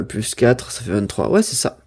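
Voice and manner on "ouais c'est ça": ordinary delivery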